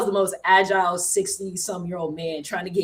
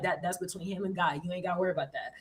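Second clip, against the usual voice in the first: much softer, shrugging voice; dismissive aside; 10 dB quieter, faster than usual. Retold or imitated. The friend brushing off her worry, soft and dismissive.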